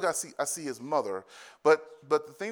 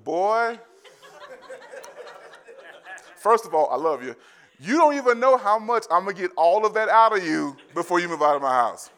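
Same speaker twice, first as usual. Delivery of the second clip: laughing dad; 6 semitones higher, 6 dB louder than usual. Retold or imitated. Dad answers son, laughing.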